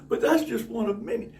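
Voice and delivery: usual speaking voice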